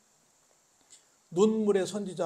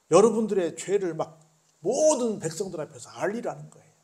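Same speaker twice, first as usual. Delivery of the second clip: louder and higher preaching; forceful sermon exclamation; exclaiming; 4 semitones higher, 11 dB louder than usual. Acted. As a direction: drive the point home loudly.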